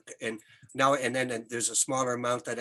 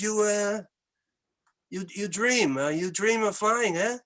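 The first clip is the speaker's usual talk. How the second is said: put-on slow Québécois accent, pitched higher; a biker confiding in a Québécois accent; 5 semitones higher, slower than usual. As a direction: lean on the Quebec French vowels, slowly. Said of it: "I'm gonna use an accent".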